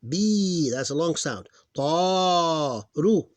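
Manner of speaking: teacher counting syllables aloud; sounding out. Shown as a demonstration. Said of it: "three syllables".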